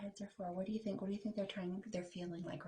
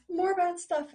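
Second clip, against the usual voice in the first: high, louder alarm; rising dread; 12 semitones higher, 13 dB louder than usual. Acted. Sam growing alarmed at the newspaper. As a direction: build up the worry.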